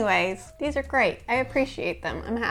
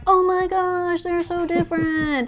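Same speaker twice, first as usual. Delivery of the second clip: mock excited gasp; 7 semitones higher, 4 dB louder than usual. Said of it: "like, going".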